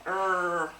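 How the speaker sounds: "er" held as an American R; untrilled "er" in the throat. Shown as a demonstration. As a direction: draw out "er" the American way. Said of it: "the American R".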